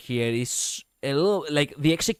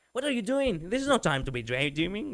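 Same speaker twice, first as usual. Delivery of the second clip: mild, casual scolding; soft-edged telling-off without panic; everyday scolding; 4 semitones higher, 4 dB quieter than usual. Shown as a demonstration. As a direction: scold lightly, like on a normal Sunday. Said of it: "she's scolding her on a normal Sunday".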